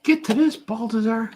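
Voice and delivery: very high-pitched jeer; heckling critic. Acted. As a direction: throw a squeaky taunt at the artist. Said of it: "hope that critic leaves me alone".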